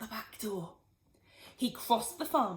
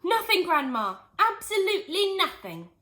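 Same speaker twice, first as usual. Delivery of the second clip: higher, louder call to another room; called back; 9 semitones higher, 6 dB louder than usual. A narrator's reading. George calls back to Grandma in next room, loud call. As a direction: call it back through the wall, innocent. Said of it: "he called back".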